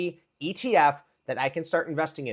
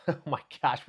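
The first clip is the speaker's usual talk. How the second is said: ordinary delivery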